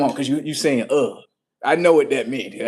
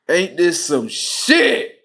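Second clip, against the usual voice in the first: slow, louder, stretched disbelief; drawn out disbelief; 3 semitones higher, 6 dB louder, much slower than usual. Retold or imitated. Father hearing about the pregnancy, slow and loud disbelief. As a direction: stretch every word, louder.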